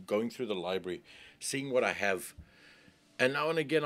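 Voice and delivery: normal talking voice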